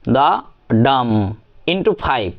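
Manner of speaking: "the dam" as da-DUM: short da, then a long, held dam; ритм ямба. Shown as a demonstration. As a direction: chant "the dam" slowly, weak syllable then strong one. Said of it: "সেটার pattern হবে".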